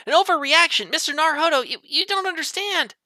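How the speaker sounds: very high, alarmed outburst; frantic warning. Acted. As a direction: squeal it as a dire warning. Voices Sholmes warning of danger.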